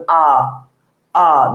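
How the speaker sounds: আ as a held, open central vowel; vowel demonstration. Shown as a demonstration. Teacher has students say it along, open আ demonstration. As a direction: sound out আ alone, mouth open. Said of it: "আমার সাথে সাথে উচ্চারণ করেন".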